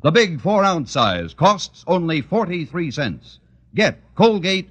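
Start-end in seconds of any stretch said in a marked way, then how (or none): none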